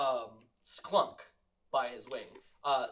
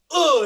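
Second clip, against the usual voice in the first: much higher, louder effort grunt; straining grunt; 11 semitones higher, 19 dB louder than usual. Acted. Caretaker forcing herself through door, loud straining grunt. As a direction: shove hard and grunt.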